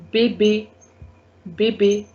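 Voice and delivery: "bebe" said bay-bay; accented E sound. Shown as a demonstration. Teacher giving an accent aigu example, "bebe" as bay-bay. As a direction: give "bebe" an ay in both syllables. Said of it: "A sound you need to give".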